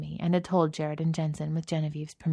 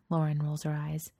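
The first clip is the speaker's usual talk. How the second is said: plain reading voice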